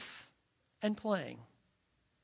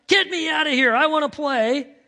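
high, loud, retold toddler demand; child's cry; 8 semitones higher, 9 dB louder than usual. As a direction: cry out like an impatient small child. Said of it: "He hears my cry".

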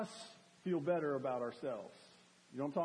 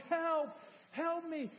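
acted yell for help; 11 semitones higher, much slower than usual. Preacher acting a lifter yelling for help. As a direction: shout for help under a crushing weight. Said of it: "I'm yelling".

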